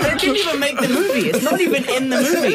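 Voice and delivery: high, loud protest over laughter; comic indignation. Real, not acted. Actor griping to the audience about a wasted punch, comic indignation. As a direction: complain in exasperated disbelief.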